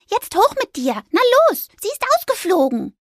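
high, urgent mouse voice, jumping up on na los; eager mouse. A narrator's reading. Little mouse hurrying the ghost up the tree. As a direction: shoo him upward, excited. Fipper urging Anton.

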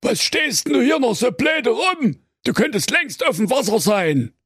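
comic grumpy fish king shouting at his son; bellowing king. Acted. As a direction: scold the lazy prince son at top volume.

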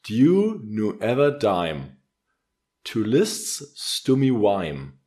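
very slow, flat reading of lyrics. Shown as a demonstration. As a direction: read each word slowly without rhythm. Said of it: "komplett ohne Rhythmik".

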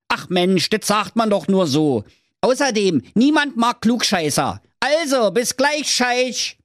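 high, cheeky Berlin voice; cocky Berlin hero. Acted. Schnitzelschorsch accepts the king's challenge, high cheeky Berlin voice. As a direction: toss off the challenge with swagger.

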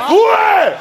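high, loud yell for silence; shout. Acted. Shouting down the audience.

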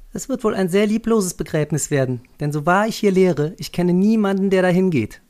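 high, loud, snide teacher's voice; young teacher. Acted. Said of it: "meinte die eine".

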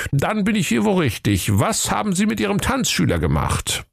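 high, official interrogation voice; police inspector. A narrator's reading. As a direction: question the suspect like a storybook cop. Inspector begins questioning suspect.

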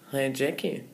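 low, soft, offhand greeting; casual. Retold or imitated. Idol casually greets the nervous fan, laid-back. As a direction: toss it off low and quiet, imitating him. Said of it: "dann sagt er ganz lässig".

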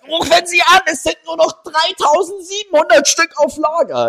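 high, loud teleshopping pitch; infomercial. Retold or imitated. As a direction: push the scarcity like a TV seller. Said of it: "Teleshopping auch was Geiles". (laute, hohe Teleshopping-Stimme)

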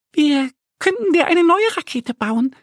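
very high little duck voice, helpful; Annie suggesting. Acted. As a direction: offer it brightly as the duckling. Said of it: "meint Annie".

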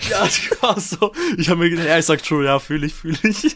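mit Lachen, etwas höher; laughing. Retold or imitated. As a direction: lachend nachgemacht. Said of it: "deine Antwort war auch so eigentlich nicht ganz passend".

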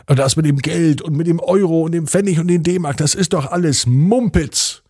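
put-on old neighbour calling everything nonsense; grumbling old neighbour. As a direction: dismiss it all as nonsense. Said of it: "sagte er noch".

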